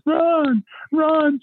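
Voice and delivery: high-pitched imitation of a panicked wife; panicking wife. Wife phoning a friend for help, panicking. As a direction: cry out in mock panic, voice leaping up high. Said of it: "she's, she's panicking".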